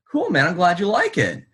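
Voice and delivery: high, relieved, eager reply; nervous cheer. Retold or imitated. Driver relieved the cop is a fan, high eager thanks. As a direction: jump up bright with relief.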